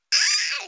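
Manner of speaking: sudden loud high yelp; a burst of frustration at the page; frustrated yelp. Real, not acted. Reader stumbling on a name, loud frustrated yelp. Stuck reading a hard name.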